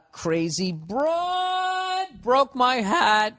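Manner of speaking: high, drawn-out overdramatic wail; hammed-up heartbreak, slow and high; mock melodrama. Host mocking a dramatic listener's letter, overwrought melodrama.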